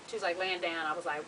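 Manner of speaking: ordinary talk, nothing marked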